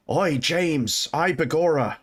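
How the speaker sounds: mock Irish brogue; Irish accent. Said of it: "Eddie was Irish for some reason".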